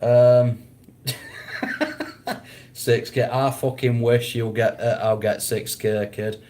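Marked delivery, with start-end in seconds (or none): 1.1-2.4 high breathless laugh. Real, not acted. Laughing at the six K joke.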